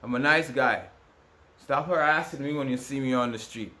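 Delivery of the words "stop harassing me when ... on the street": ordinary delivery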